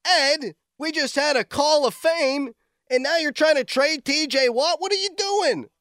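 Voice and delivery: can't believe what he hears; incredulous. Real, not acted. Host stunned by a caller's trade idea, incredulous. Caller proposes trading Watt.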